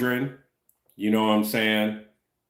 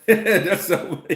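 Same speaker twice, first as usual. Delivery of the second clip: laughing; 7 semitones higher than usual. Real, not acted. Laughs while reading chat comment.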